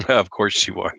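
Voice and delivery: ordinary talk, nothing marked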